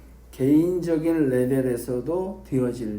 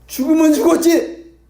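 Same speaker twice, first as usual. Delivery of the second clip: Peter's vow; 13 semitones higher, 10 dB louder than usual. Acted. Peter vows loyalty to Jesus.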